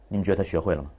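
usual speaking voice

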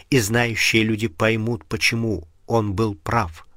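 plain reading voice

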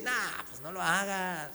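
lightly voiced inner tempter, quiet and dismissive; voice of the flesh. Preacher voicing his own flesh refusing to obey, mock tempting. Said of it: "Mi carne que dice".

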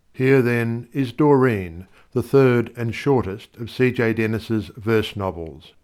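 ordinary delivery

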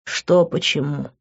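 slow, tired question; weary. A narrator's reading. Exhausted man answers her question, weary. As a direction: ask it slowly, worn out. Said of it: "устало произнёс я".

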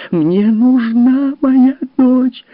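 very high, loud, drawn-out scream; full shriek. Acted. Mother screams while beating her husband. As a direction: shriek with everything. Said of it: "её голос перешёл в визг".

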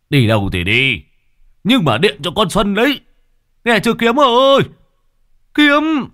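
loud, high yell after the son; calling out. Acted. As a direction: shout after him as he leaves. (gọi với theo thật to)

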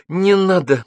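громкая мольба на высокой ноте; умоляй остановить драку. A narrator's reading. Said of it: "просила Алла". (умоляюще, высоко)

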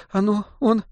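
high, soft, sobbing words; lady sobbing about sleeping child. A narrator's reading. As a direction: blurt through tears. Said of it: "всхлипнула дама".

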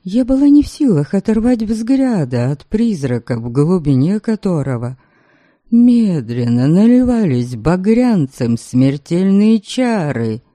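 usual speaking voice

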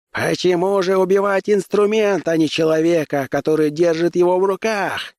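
high-pitched public challenge; shouting. A narrator's reading. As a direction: cry out to a crowd, voice pitched far up. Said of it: "крикнул он". (высокий пронзительный выкрик)

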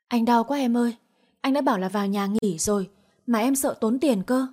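usual speaking voice

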